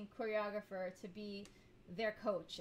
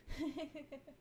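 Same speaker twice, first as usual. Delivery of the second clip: short, soft, higher-pitched laugh; real chuckle; 5 semitones higher, 5 dB quieter than usual. Real, not acted. Vlogger gets sidetracked and chuckles. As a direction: chuckle quietly to yourself. Laughs after losing the thread.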